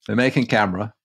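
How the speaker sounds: narration in mock broad Yorkshire; lightly Yorkshire storyteller; Yorkshire accent. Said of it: "Do the rest of it in that accent".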